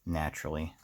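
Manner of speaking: ordinary talk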